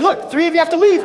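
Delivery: firm, loud order to the group; 1 loud demand. Retold or imitated. In line at the ride, loudly ordering three strangers out. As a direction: raise the voice and tell them to leave. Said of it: "I started talking loudly".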